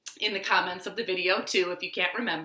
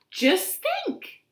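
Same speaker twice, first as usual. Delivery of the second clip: high marvelling bird voice; robin. Acted. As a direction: wonder aloud up high. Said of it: "said the robin".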